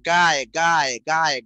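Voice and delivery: "গায়" hit loudly with a clear aay glide; loud, emphatic "গায়", sounding out the aay; syllable demonstration. Shown as a demonstration. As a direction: punch out "গায়" as a separate, repeated syllable. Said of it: "গায়ক উচ্চারণে গায় হয়".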